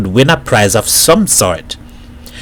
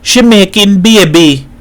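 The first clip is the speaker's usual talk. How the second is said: higher-pitched Trinidadian voice; emphatic Trinidadian announcement, pitched up; Trinidad accent; 8 semitones higher, 4 dB louder than usual. A narrator's reading. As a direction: blurt it out in Trinidad dialect. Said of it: "He spoke with his Trinidad accent".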